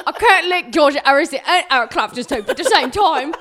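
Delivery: put-on exasperated posh-girl complaint, laughing; mock British Patty.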